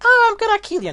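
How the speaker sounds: a squeaky joke take of the threat; the wrong take, squeaky and high; wrong silly voice. Shown as a demonstration. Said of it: "that's not the Naga voice".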